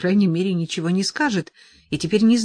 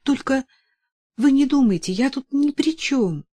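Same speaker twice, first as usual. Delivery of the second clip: apologetic; 8 semitones higher than usual. A narrator's reading. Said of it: "извиняющимся тоном сказала".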